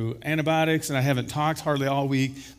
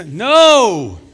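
booming, high, drawn-out NO; loud preacher's cry of denial; emphatic shout; 6 semitones higher, 13 dB louder than usual. Acted. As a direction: thunder the refusal. Preacher thunders answer to own question.

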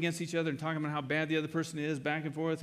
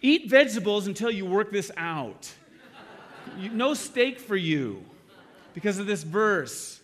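mock scolding; 4 semitones higher, 6 dB louder than usual. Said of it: "put it down and say".

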